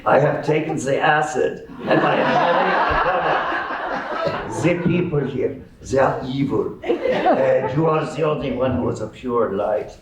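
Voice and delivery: put-on thick German accent, intense and pleading; Colonel Klink. Tripping old man begging a boy to stay, German accent. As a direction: confide desperately in a German accent. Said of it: "spoke in a thick German accent".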